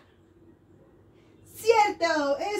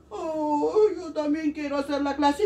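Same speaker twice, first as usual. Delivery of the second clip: coronavirus. Acted. Virus begs to join the class, lightly voiced villain. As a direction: play the germ pleading to join in.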